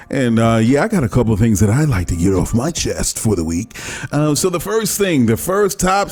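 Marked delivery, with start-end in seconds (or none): none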